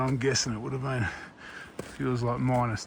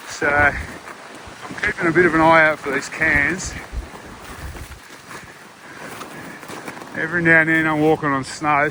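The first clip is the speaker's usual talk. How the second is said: loud, raised voice over gusts; shouting over wind; 2 semitones higher, 4 dB louder than usual. Real, not acted. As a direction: call out to be heard in the wind. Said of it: "you can hear me over the wind".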